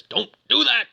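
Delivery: high-pitched put-on computer protest; alarmed command. Computer as Susan cuts the power, alarmed command. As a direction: act out an urgent order to stop.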